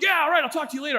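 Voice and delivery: high, fired-up sign-off; mock-eager phone goodbye; acting out being all worked up; retold angry excitement. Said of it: "I get all angry and excited".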